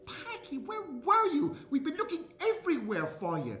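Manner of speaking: high, anxious scolding relief; frantic mother. Acted. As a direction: call out like a worried mother. Frantic mother finds missing child.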